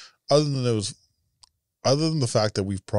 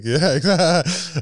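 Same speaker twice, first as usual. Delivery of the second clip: laughing; 9 semitones higher, 5 dB louder, slower than usual. Real, not acted. Laughing at the joke.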